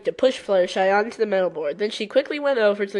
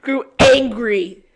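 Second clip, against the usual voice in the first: louder, slow "Grew angry" with mock fury; mock angry reread; 3 semitones higher, 8 dB louder, slower than usual. Real, not acted. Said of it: "Why did I say that?".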